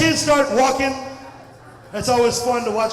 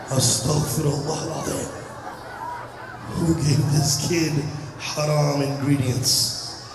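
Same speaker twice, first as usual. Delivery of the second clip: lower, mock-shocked pious muttering; scandalised pious elder; 8 semitones lower, 4 dB quieter than usual. A pious onlooker at a wobbly toddler, mock-shocked and lower. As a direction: play the horrified religious uncle, voice dropped.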